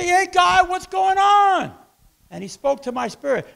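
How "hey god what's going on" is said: high, loud shout with drawn-out end; calling out to God. Retold or imitated. Preacher retelling his plea to God, high shouted protest. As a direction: yell it up at God in complaint.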